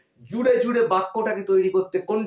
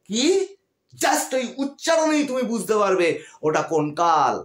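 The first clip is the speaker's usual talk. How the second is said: ordinary talk, nothing marked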